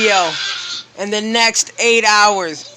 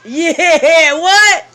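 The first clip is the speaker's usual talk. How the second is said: loud, high hype-man yell; excited shout; 7 semitones higher, 6 dB louder than usual. Real, not acted. Hyping up his son loudly.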